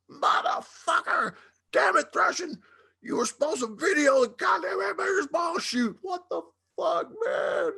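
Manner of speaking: high yelling rant; furious shout. Retold or imitated. Dime chewing out Thrashin' over the missing video, yelling high. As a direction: blow up at the forgotten camera.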